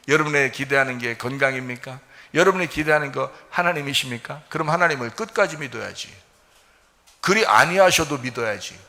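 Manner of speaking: ordinary talk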